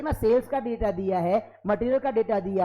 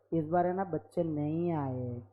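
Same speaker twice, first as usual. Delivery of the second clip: softer, slow, begging tone; mock pleading tenant; 7 semitones lower, 7 dB quieter than usual. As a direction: beg the landlord, half-joking. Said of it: "landlord को ऐसा तो ना बोल सकते".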